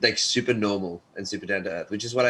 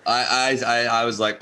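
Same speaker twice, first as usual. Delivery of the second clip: ordinary talk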